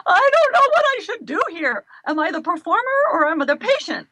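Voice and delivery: high, loud imitation of a tearful wail; mock crying. Patient torn between performing and being treated, mock-crying wail. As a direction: wail it as a retold on-camera meltdown. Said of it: "crying on camera, going".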